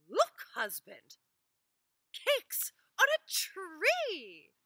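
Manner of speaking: high, loud, slow cry of wonder; wife shouting in amazement at a cake tree; amazed wife. Acted. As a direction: marvel at it, calling out to your husband.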